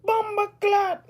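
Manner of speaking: high-pitched mock Jamaican outburst; upset Jamaican patois. Imitating islanders when they get upset, high Jamaican patois. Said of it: "when they get upset and they got that patois".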